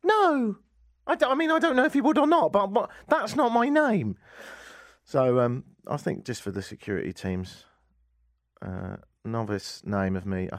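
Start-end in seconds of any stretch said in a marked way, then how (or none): 0.0-4.2 high-pitched protesting outburst; indignant correction, voice pitched right up; comic indignation. Retold or imitated. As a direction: protest it high, baffled and put out. Protesting at the wrong name.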